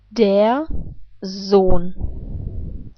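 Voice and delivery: "Der Sohn" with a voiced z and a long o; teaching the German word; German demo. Shown as a demonstration. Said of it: "let's put this all together".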